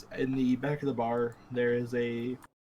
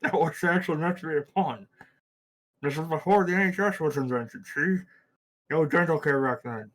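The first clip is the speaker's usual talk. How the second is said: lisping through an overbite; mushy, garbled overbite speech; 3 semitones higher, 6 dB louder than usual. Acted. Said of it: "I literally cannot understand what you were saying".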